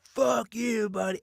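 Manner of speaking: high-pitched retold road rage, Long Island accent; guttural Long Island accent. Driver snapping after trying to be polite, guttural Long Island accent. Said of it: "it was so guttural Long Island".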